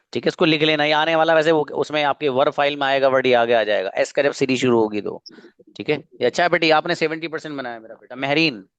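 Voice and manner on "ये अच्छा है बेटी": ordinary talk, nothing marked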